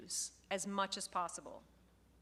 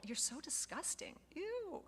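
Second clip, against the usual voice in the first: mock disgust; 6 semitones higher, 8 dB quieter than usual. Shown as a demonstration. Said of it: "we say stuff like this".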